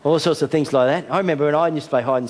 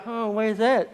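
mock searching; 9 semitones higher, 4 dB quieter, slower than usual. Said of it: "And he'll go".

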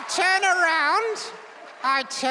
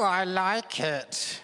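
deep dummy voice; 10 semitones lower, slower than usual. Pretend. Ventriloquist dummy talking.

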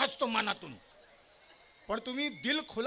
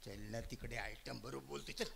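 hushed late-night murmur; 13 semitones lower, 10 dB quieter than usual. Acted. Man voicing phone call late night.

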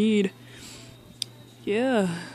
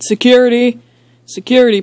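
raised, louder urgent call; calling for security; 4 semitones higher, 6 dB louder, faster than usual. Acted. Alarmed host summoning guards to remove a head, calling out.

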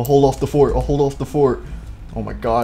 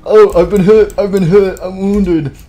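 loud, higher, hammed-up distress; wounded soldier cry; 4 semitones higher, 8 dB louder, slower than usual. Soldier telling team he is wounded, loud mock distress. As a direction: cry out as a soldier hit in battle. Soldier calls out being hit.

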